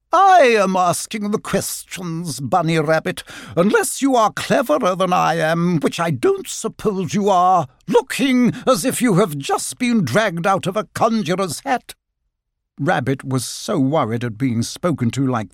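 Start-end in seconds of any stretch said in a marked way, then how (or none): 0.1-11.9 high, imperious lady otter; haughty otter. A narrator's reading. Otter putting the rabbit in his place, haughty. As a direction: talk down in a high, grand voice.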